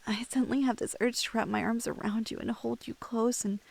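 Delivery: ordinary talk, nothing marked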